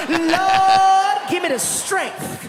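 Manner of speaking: loud, laughing plea to the Lord; 1 exasperated shout to the Lord. Acted. Woman shouting a prayer to hold back her anger. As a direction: holler up to heaven for patience.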